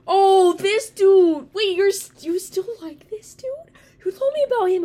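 high, loud, stretched "Oh, this dude"; genuinely shocked recognition; surprised. Real, not acted.

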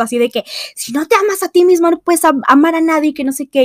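mocking quote. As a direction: rattle off the old saying dismissively. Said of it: "yo siempre he predicado esto". (citando con burla)

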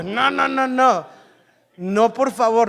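laughing "no, no, no"; laughing interruption. Retold or imitated. Pastor cutting off the preacher, amused and laughing. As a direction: stop him while laughing.

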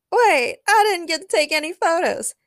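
high, loud protest; shouting after someone. A narrator's reading. Daniel runs after Spider-Man for photos, shouting. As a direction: call out urgently as they get away. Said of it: "Daniel shouted as he ran after".

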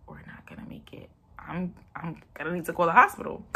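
fast, hushed worried whisper; anxious whisper. Retold or imitated. Whispering to herself, late for her C-section. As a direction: rush it out under your breath.

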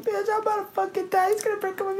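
very high, mock-tearful whine; retold hysterical crying. Retelling a meltdown to the boyfriend, hysterical crying. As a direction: squeal it in imitated hysterics. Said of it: "Why are you crying".

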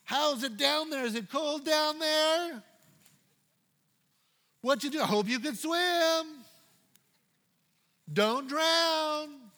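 high, slow, taunting voice; sarcastic taunt at a sinking man; mocking Jesus. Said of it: "He didn't say".

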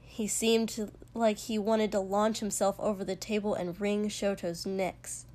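usual speaking voice

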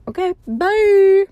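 excited drawn-out sign-off; cheery goodbye. Real, not acted. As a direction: sing out a big bright farewell.

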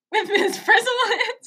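high-pitched mock quote, laughing; hammed-up catchphrase. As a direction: echo the quote high and amused. Mock-singing a show catchphrase.

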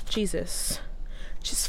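exasperated whisper. Real, not acted. To pretend-pushy listeners, a fed-up whisper. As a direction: breathe it out, fed up.

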